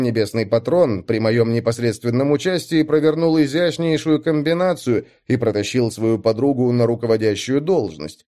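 ordinary delivery, nothing marked

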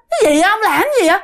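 high, startled wifely questions; alarmed questioning. Retold or imitated. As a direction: imitate a wife demanding what's going on. Wife questions her shivering husband. (giọng vợ hốt hoảng hỏi dồn, cao giọng)

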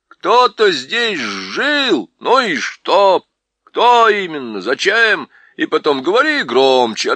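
dwarf demanding the owl speak up; deaf dwarf. A narrator's reading. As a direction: holler like a befuddled deaf dwarf.

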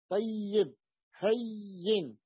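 "طيب، هين" with long held double y; doubled ya demo. Shown as a demonstration. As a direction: slowly stretch tay-yib, hay-yin in "طيب، هين". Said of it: "والياء الثانية أدغمت في هذه الياء". (نطق "طيب، هين" ببطء مع تشديد الياء)

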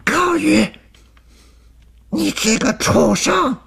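very high furious yell with drawn-out words; angry roar. A narrator's reading. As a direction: roar the accusation into the cave. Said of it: "对着洞内大声地吼着". (高声怒吼，拖长字音)

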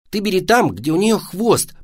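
put-on helpful kid calling out advice; advising boy. A narrator's reading. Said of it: "посоветовал мальчик".

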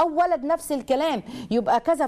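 usual speaking voice

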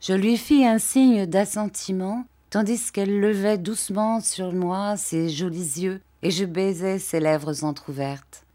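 plain reading voice

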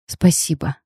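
softly spoken, subdued thanks; quiet thanks. A narrator's reading. Bride thanking her stern groom for food, quiet. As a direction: say it small and soft. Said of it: "тихо поблагодарила я его".

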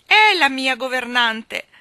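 slow, high, very loud dismay; loud, drawn-out exasperation; horrified outburst. A narrator's reading. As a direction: cry it out in horror.

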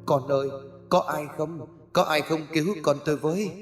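high, softened cry, as if heard from afar; desperate call for help. Acted. Old man in a nightmare calling for his child, muted desperate cry. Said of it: "vừa bước về phía trước vừa hét lên".

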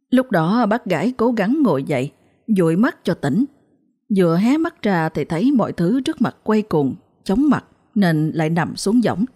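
ordinary delivery

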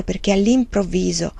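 ordinary delivery, nothing marked

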